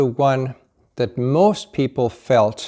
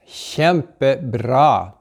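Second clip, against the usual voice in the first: slow Norwegian praise; much slower than usual. Shown as a demonstration. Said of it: "praising in Norwegian".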